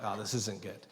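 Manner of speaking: low, hushed aside; quiet dread. Retold or imitated. Husband finding his wife crying upstairs, quiet dread. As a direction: sink low and soft with dismay.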